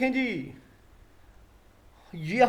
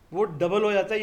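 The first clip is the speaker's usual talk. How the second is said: ordinary talk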